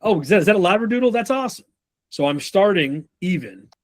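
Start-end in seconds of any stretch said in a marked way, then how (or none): 0.0-1.6 high, chirpy small talk; overly friendly. Acted. Salesman greeting a customer and his Labradoodle, overly friendly. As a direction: gush like an eager salesman, pitch climbing. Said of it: "I can move to friendly".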